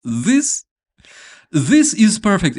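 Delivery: high, loud thrilled burst; excited gushing. Retold or imitated. Recalling first viewing, delighted at how perfect it is, high and loud. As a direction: act out delighted disbelief at a perfect cliche. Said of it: "I was like".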